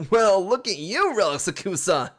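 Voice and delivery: mock the player in a put-on British accent. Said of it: "I don't know British accents".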